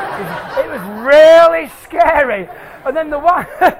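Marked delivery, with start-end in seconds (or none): none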